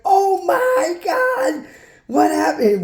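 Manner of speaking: high, drawn-out mock panic wail; freaking-out mother. Mother seeing her bleeding son come home, imitated high-pitched freak-out. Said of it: "My mother freaks out".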